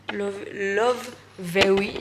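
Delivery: slow, loud, careful English words in a strong French accent. Real, not acted. Vlogger thanking the brand in English, French accent. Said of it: "Excusez-moi pour encore la prononciation".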